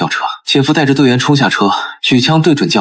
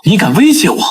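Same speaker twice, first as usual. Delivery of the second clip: angry; 7 semitones higher than usual. Acted. Brother-in-law angrily confronts the anonymous caller, raised voice. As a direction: hurl the question back in fury. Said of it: "姐夫怒道".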